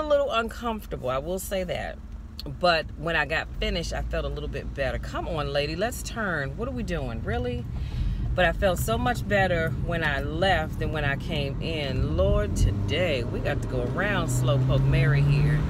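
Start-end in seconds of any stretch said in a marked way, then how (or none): none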